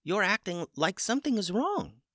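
a puzzled small bird speaking up; chicken voice. A narrator's reading. Chicken voice continues.

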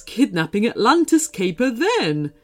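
grand, weary royal scolding; regal seahorse voice. Acted. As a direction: scold the old villain grandly.

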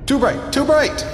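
very high, urgent yell; pained yell. A narrator's reading. Narrator blinded by the god's sudden light, high pained yell. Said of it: "I yelled".

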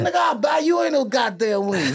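high, loud mock yell; high retold yell over wings; retold shout. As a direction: yell it like Faison flexing money. Faison quoted shouting.

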